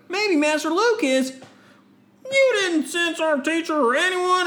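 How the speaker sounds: high, bright heroic voice; young Jedi voice. Acted. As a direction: play the eager young Jedi. Voicing Jedi character.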